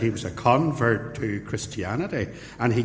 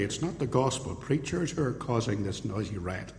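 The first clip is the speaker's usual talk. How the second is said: ordinary talk, nothing marked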